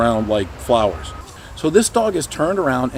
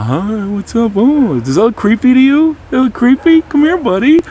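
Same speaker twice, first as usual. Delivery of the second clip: high, loud gushing grandma voice; overbearing cooing at a dog, pitched way up; creepy grandma; 10 semitones higher, 7 dB louder than usual. Acted. Grandma coming at a nervous dog, high creepy gushing. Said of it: "like grandma".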